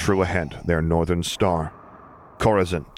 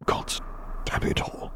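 low, softer grumble under his breath; muttered curse; 7 dB quieter than usual. A narrator's reading. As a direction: curse quietly so the lord won't hear. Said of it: "Piet muttered".

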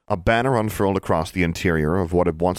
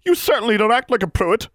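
put-on much higher, louder sharp old woman's snap; snapped rebuke; 5 dB louder than usual. A narrator's reading. Goody Pruitt snapping at Livia. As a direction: bite the words off at her. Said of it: "Goody snapped".

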